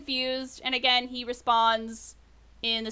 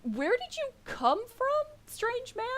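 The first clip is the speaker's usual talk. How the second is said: high, slow, careful question; gently puzzled orc, pitched up; puzzled orc farmer; 11 semitones higher, 4 dB quieter than usual. Acted. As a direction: ask the stranger slowly, voice lifted. Voices the male orc.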